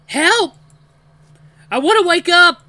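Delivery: high, panicked scream; desperate yelping. A narrator's reading. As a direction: yelp loudly in terror. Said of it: "but I continue to yelp".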